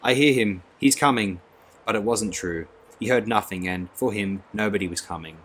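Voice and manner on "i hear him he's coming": high, loud shout; forced shout. A narrator's reading. Bernard faking rapture, shouting with the others. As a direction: shout to join the crowd. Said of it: "Bernard also jumped and shouted".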